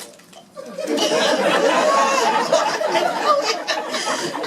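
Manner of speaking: high unvoiced burst of laughter; real laughter. Real, not acted. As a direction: laugh out loud at the memory. Laughing at homesick memory.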